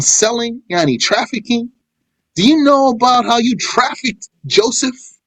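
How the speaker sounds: high, pressing reproach in questions; retold scolding with rising, insistent questions. Joseph confronting his brothers over their crimes, scolding. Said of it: "in scolding them".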